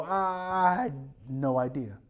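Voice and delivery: low, slow drawn-out admission; retold realization. Preacher answering God, slow dawning realization. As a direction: stretch it out low, as if it dawns on you. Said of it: "And I go".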